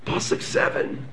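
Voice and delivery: ordinary talk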